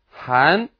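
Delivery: "han" rising like a question; second tone. Shown as a demonstration. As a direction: lift "han" upward from middle pitch. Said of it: "second tone".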